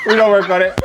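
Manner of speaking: loud, laughing reassurance. Real, not acted. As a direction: call it out through laughter. Laughing at failed accent attempts.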